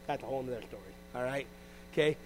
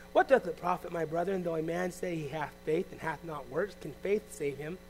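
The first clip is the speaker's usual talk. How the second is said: measured solemn reading; scripture reading; 2 semitones lower, 4 dB quieter than usual. Acted. Preacher reading James to the congregation, solemn. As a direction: read the verse with weight.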